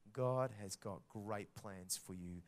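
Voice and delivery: ordinary delivery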